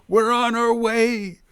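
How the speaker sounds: loud, high, drawn-out cheer; joyful yell. A narrator's reading. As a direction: shout it out with joy. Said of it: "Duroc yelled with joy".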